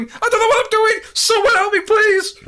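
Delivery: very high, loud cry for help; panicked screaming. Retold or imitated. Last survivor begging teammates for help, panicked screaming. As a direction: scream it like a helpless coward under attack. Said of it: "I just remember Jack screaming".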